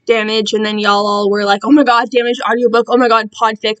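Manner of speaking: ordinary talk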